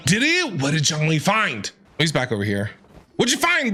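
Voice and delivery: ordinary talk, nothing marked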